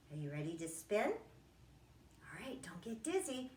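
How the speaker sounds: ordinary talk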